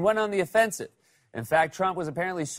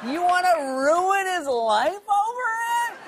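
much higher, mock-outraged defender; indignant Republican; 12 semitones higher, slower than usual. Republicans excusing slave owning, high mock-indignant protest. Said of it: "Republicans would probably say".

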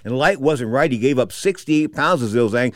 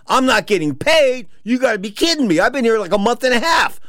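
high-pitched indignant outburst; re-enacted incredulous complaint, pitched way up; outraged disbelief; 10 semitones higher than usual. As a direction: protest as if robbed of your wages. Retold protest at losing his pay.

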